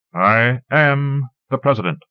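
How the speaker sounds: resonant, carrying voice. A narrator's reading. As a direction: project it to the back rows. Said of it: "begins speaking with a resonance that surprises even himself".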